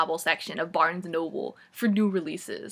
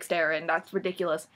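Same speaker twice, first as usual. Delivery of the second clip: ordinary talk, nothing marked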